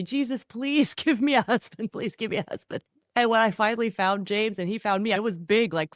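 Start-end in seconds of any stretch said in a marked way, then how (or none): 0.0-2.8 put-on praying girl. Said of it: "I was that girl who was praying every day".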